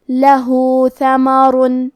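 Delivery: لہو with the vowel held for two counts, slowly; two-count madd. Shown as a demonstration. Teacher demonstrates the short madd, slow chant. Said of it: "آواز دو مد کے برابر لمبی".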